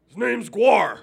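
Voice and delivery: high, loud, fast proud question; loud neighbor. Retold or imitated. Mister Cook hollering to the minister about his dog, high and loud. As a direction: shout it out proudly.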